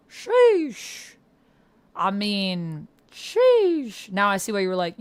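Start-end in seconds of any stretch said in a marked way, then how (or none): 0.1-1.1 high, loud, drawn-out "sheesh"; piercingly high burst of disbelief; amazed exclamation. Real, not acted. Reacting to huge donation. 3.1-4.1 high, loud, drawn-out "sheesh"; piercingly high burst of disbelief; amazed exclamation. Real, not acted. Repeated amazement at donation.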